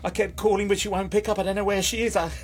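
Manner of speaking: high, halting, broken-up confession; distraught. Acted. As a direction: stammer it out, pitched high with distress. Distraught, wife has left him.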